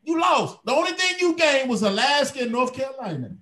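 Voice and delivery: taunting yell. Acted. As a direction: call out to him across the room, jeering. Taunting Trump about losing.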